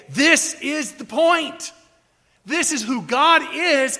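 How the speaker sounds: high, weighty stress on "point" and "is"; emphatic declaration. Acted. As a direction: drive home each word of "This is the point". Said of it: "done what we could to say".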